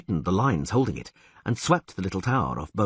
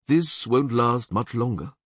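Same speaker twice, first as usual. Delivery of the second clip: slow, gloomy warning; 2 semitones higher, slower than usual. Acted. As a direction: say it with dark foreboding. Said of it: "Krep said gloomily".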